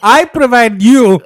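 put-on slow, loud Mexican accent. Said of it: "but with a Mexican accent".

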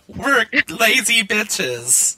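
high, drawn-out self-mocking wail; slow, high comic moan; mock lament. Real, not acted. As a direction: stretch it out high in joking despair. Mock lament over their laziness.

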